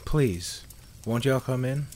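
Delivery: shy, voice cracking. A narrator's reading. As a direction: let the voice crack shyly. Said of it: "in a shy and cracking voice".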